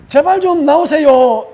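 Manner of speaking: loud, slow, drawn-out entreaty; pleading. Shown as a demonstration. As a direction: call out imploringly, stretching the words. Demonstrates pleading with God to appear.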